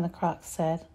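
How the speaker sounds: usual speaking voice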